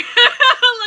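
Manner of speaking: sudden high, loud laugh. Real, not acted. Laughing at her own joke about the other girls. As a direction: let a sharp laugh out.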